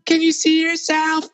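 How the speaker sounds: very high, shocked mock voice; imitating Pat. Pat, horrified at his bloody face, in a very high mock voice. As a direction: shriek the question like a horrified friend. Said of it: "And she's like".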